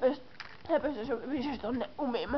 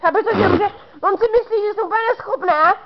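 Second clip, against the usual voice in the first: high, loud, upset questioning; really worked-up, incredulous raised voice; indignant outburst; 7 semitones higher, 5 dB louder, faster than usual. Real, not acted. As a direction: protest it at a raised, higher pitch. Upset outburst at being thought incapable.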